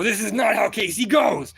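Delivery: high, loud battle cry; retold battle shout. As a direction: yell it like you're winning the fight. Retelling the fight, shouting at him.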